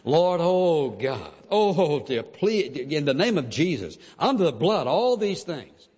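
overdone pleading prayer; mock desperate pleading. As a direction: ham up a desperate plea to God. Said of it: "pray long enough and plead long enough".

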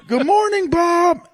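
high squawky parrot voice. Retold or imitated. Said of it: "he'd wake up every morning to".

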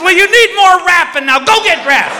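high, scolding mock-wife order; bossy wife. Wife sending husband off to dress, exasperated.

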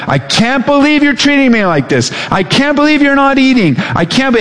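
high, whiny voice of an indignant body; complaining body. Retold or imitated. Pastor voicing the hungry body's complaint, high and whining. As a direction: squeal it like your own body feeling wronged. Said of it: "your body is just like".

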